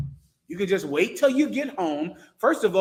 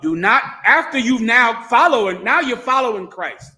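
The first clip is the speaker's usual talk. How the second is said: scolding shout; 4 semitones higher, 7 dB louder than usual. Real, not acted. Preacher scolding the women.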